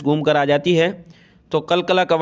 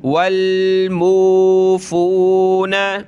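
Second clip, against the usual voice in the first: slow chanted recitation; teacher chanting the verse for students; Quran recitation; 3 semitones higher, 3 dB louder, much slower than usual. Shown as a demonstration. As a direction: recite slowly with a melodic lilt.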